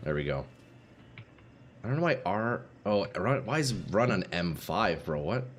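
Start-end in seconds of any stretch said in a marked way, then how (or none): none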